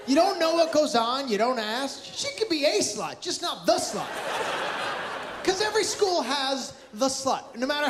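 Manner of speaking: high, near-shouting worried dad; agitated rant. Real, not acted. Worried dad ranting on stage.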